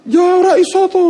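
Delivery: grumbling complaint. Retold or imitated. Classmates cursing the translation homework, a mock grumble. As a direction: gripe like a fed-up student. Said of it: "semua maki-maki".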